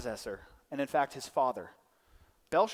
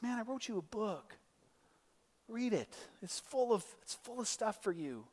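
soft, higher-pitched pleading; soft exasperation; 5 semitones higher, 9 dB quieter than usual. Acted. God to us who don't read Scripture, softly exasperated. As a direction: plead quietly as if stating the obvious. Acted God answering complaint.

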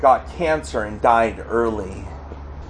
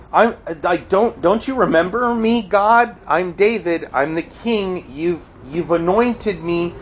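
higher-pitched, pleading despair; despairing plea; 7 semitones higher than usual. Acted. David reminding God he was anointed, despairing plea. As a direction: plead with God as the abandoned king. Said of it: "He's expressing this deep despair".

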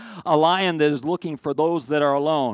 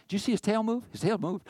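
mock excited zoo visitor; 7 semitones higher, 4 dB quieter than usual. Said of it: "people get so excited".

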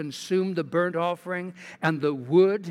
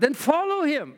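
raised, emphatic Elijah voice; prophet's challenge; 9 semitones higher than usual. Acted. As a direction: throw down the challenge as a preacher. Elijah challenges the people, read aloud.

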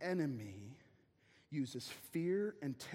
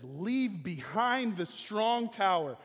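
slow coaxing lie; 3 semitones higher, 6 dB louder, slower than usual. Acted. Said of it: "he lies and says".

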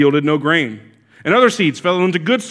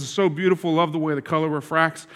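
softer, fawning admiration; gushing salesman; 2 semitones lower, 6 dB quieter than usual. Retold or imitated. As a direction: coo over the ring like a flatterer. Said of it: "the salesman oohs and ahs".

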